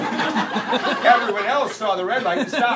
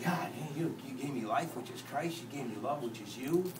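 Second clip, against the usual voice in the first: low, hushed talk to God; thankful prayer; 7 semitones lower, 11 dB quieter than usual. Retold or imitated. Thinking about things and talking to God, hushed prayer. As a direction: murmur a humble thanks.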